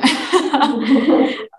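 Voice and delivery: short amused laugh. Real, not acted. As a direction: break into a light chuckle. Amused at own remark.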